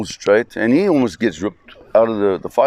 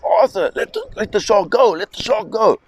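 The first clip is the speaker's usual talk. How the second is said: urgent shout; 11 semitones higher than usual. Retold or imitated. Father on the boat yelling at Arthur to release the shark, urgent high shout. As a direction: yell it as a frantic warning to let go. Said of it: "my dad shouts to Arthur".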